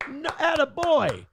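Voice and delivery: slow, high cheer; mock cheering. Cheering Rubio's comeback to a senator, slow cheering. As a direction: egg him on like a fan. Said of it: "And I'm like".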